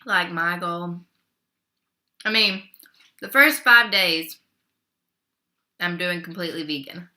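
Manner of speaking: normal talking voice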